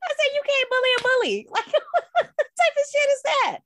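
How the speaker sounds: high, giggly voice, cracking up while talking; real laughter breaking into fast, high-pitched words; laughing. Real, not acted. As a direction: laugh through the punchline, pitch way up. Laughing at own punchline.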